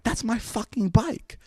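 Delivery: retold high-pitched, drawn-out outrage; indignant outburst. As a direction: imitate your younger self, high and indignant. Retelling his outraged cry at seeing bike.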